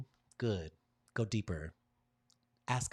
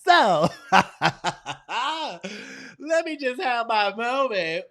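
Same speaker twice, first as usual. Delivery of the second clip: much higher, louder playful hype; excited hype; 12 dB louder than usual. Real, not acted. Speaker hyping the room before sharing her screen, excited. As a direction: bubble over with excitement.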